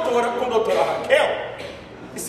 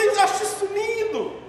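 doting mother, higher; 7 semitones higher than usual. Retold or imitated. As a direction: coo it like a doting mum. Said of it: "A mãe fala". (mãe babona, mais aguda)

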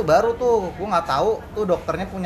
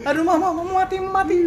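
higher, hammed-up wail of a kid; wailing child; 11 semitones higher than usual. Storyteller mimics the boy wailing for his mother. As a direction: overact a kid crying for mama. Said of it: "Lu bayangin lu ya".

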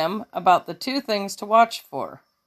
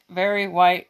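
usual speaking voice

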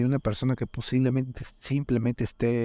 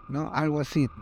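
usual speaking voice

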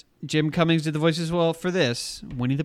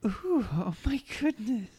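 high, soft, fluttery mock swoon; put-on girlish coo, pitched up and hushed; Valerie Vomit; 6 semitones higher, 8 dB quieter than usual. Co-host imitating how the puppet girl was written, high soft swoon. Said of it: "they wrote Valerie Vomit to be like".

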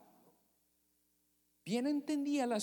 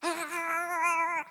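panicked cry; 8 semitones higher than usual. Acted. Disciples panic.

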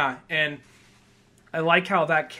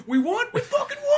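squealed high, disbelieving joy; mock elated cheer; 3 dB louder than usual. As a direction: cheer like amazed players. Players celebrating win.

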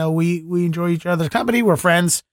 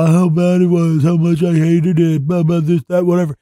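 lightly mocking grumblers, a bit louder; other people complaining; 4 dB louder than usual. Host imitating people who knock Zoom comedy, mock complaining. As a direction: rattle off their complaints in a mild mock voice. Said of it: "what you hear about is other people".

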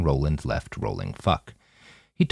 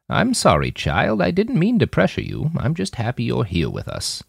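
lightly voiced gentle elder, higher; kindly old woman; 8 semitones higher than usual. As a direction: soothe the girl as a kind grandmother. Old woman Nanayazi apologizes gently.

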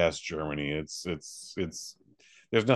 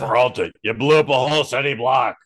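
loud, higher-pitched angry bellow; mock police-boss shout; 4 semitones higher, 8 dB louder than usual. Police boss scolding Peralta, mock angry shout. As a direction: chew out a reckless cop.

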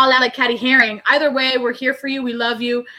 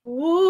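ghost wail; 2 semitones higher than usual. Pretend. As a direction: howl like a pretend ghost. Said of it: "That's the ghost of Jocelyn's past".

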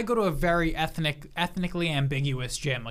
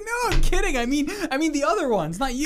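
much higher, louder squeaky backpedal; panicked backpedal; 10 semitones higher, 5 dB louder than usual. Retold or imitated. Caught insulting Steve, squeaky backpedal. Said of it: "I'm like".

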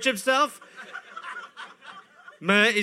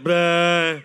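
loud silly blah; 2 semitones lower, 6 dB louder than usual. Pretend. Mock doctor exam.

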